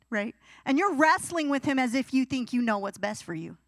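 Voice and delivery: ordinary talk, nothing marked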